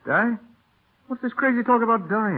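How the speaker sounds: high, stunned question; Michael hears her say she will die; shocked disbelief. Acted. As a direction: recoil in disbelief at her words. Stable hand shocked by talk of death.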